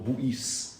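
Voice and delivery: slow "bouis", the whole word said out, "b" and final "s" sounded; old French pronunciation. Shown as a demonstration. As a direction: draw out "bouis" letter by letter. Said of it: "That's how they would pronounce it".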